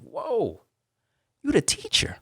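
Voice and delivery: high-pitched incredulous question; acted astonishment. Reacting to the teacher's praise, high mock astonishment. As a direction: let the voice leap up high in disbelief.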